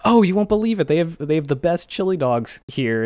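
high, loud imitated enthusiasm; excited sales pitch. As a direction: gush about it like a thrilled customer. Acting his enthusiastic menu pitch.

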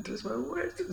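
squeaky teasing echo; high mock echo. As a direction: repeat it back in a high squeak. Mocking echo of friend's words.

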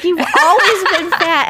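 high, loud, laughing mock of the burn; a hammed-up retelling of the insult; mock deadpan burn. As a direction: repeat the burn, giggling. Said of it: "when she's like".

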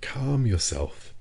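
calm a hot-headed friend; drawn-out, soothing reassurance. A narrator's reading. Professor soothing his angry companion.

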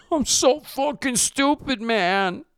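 put-on high, slow, whining man-child; whiny crybaby. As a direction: wail like a sulky kid who wants mommy. Cal regretting Halo, whiny.

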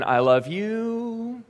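high, very slow and softly stretched; a lingering, high, slow I love you hanging in the air; drawn-out hopeful I love you. Acted. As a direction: draw out each word of I love you, waiting for an answer. Saying I love you, unanswered.

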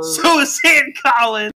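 high, loud, slow shout; drawn-out hammed-up holler; mock cheer. Friend echoes thanks to the author, mock cheering shout.

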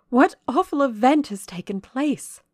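high, loud, dramatic question; alarmed lady. A narrator's reading. Miss Ingram seizing Rochester's arm, alarmed. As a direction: demand news in high-pitched alarm. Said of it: "said she".

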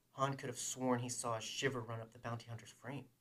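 plain reading voice

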